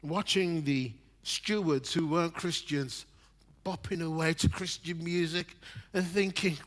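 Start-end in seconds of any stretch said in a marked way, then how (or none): none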